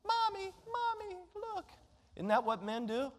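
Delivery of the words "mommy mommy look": put-on high, soft, babyish whimper for mommy; whiny little baby. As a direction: play the wounded little boy calling for mom. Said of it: "I ran off into the bathroom like a little baby".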